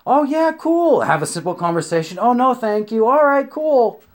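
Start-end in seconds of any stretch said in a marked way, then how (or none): 0.1-1.0 mock upbeat girl. Imitated friendly stranger reply. 2.2-4.0 higher, bright-voiced fake politeness; mock polite brush-off. Stranger politely turning down a guy, higher put-on voice.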